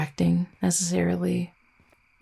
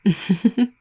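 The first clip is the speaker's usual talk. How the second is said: short, raised chuckle; amused chuckle; 3 semitones higher, 5 dB louder than usual. Acted. Vampire teasing a tired partner, amused chuckle. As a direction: let out a quick, playful laugh.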